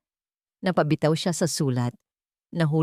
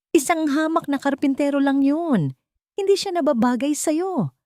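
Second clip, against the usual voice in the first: angry retort; 10 semitones higher than usual. A narrator's reading. Mateo answering his sister, angry scornful retort. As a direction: lash back with contempt. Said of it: "Ang maskara ng pagiging kalmado ay tuluyan nang natanggal".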